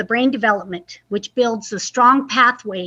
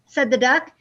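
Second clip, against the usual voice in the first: lively, expressive picture-book reading; reading to children. Acted. Librarian reading aloud to young children, lively storytime voice. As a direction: read it like story time for little kids.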